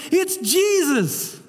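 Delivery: high, slow, awed cry of recognition; excited recognition. Acted. To the fishermen on the boat, Peter cries out high and slow in awe. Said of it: "And Peter says".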